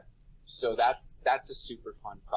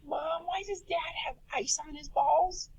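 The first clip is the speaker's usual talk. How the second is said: put-on high, childlike voice; a young son asks his mother, in a high child's voice; imitating his son. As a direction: ask it like a curious six-year-old. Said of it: "He's like".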